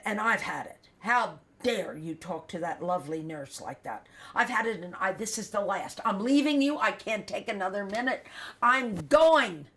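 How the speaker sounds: indignant scolding building to a loud shout; imitating a mother at the end of her rope; retold fed-up outburst. Retold mother quarrelling, walking out.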